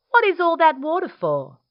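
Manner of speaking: high, loud, quick question; curious platypus. Acted. Platypus asking Dingo about the water, curious and high. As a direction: pipe up high and fast as a nosy platypus.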